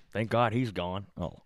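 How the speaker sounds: normal talking voice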